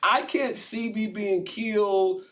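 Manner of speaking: Jesus half-laughing at his death; laughing disbelief. Retold or imitated. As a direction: chuckle at the absurd fate. Jesus voiced, laughing despair.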